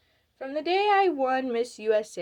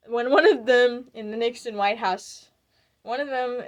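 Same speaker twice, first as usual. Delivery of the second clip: talking through a laugh, louder; amused, laughter spilling into the words; 4 semitones higher, 8 dB louder than usual. Real, not acted. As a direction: break up laughing while carrying on the story. Laughing at her own story.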